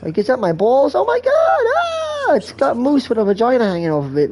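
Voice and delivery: high-pitched mock panic; mock horror. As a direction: cry out in exaggerated, squealing horror. Mock horror at grotesque image.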